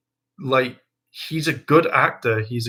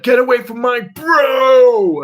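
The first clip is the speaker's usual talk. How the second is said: much higher, louder, slow mock battle cry; hammed-up Nate; 12 semitones higher, 8 dB louder, slower than usual. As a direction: overdo the heroic kick line. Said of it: "and he's like".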